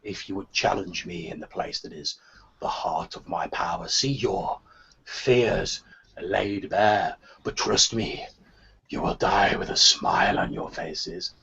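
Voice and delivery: low, slow, rasping menace; sinister rasping voice. Acted. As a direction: rasp it out like a lurking villain. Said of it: "a sinister, rasping voice".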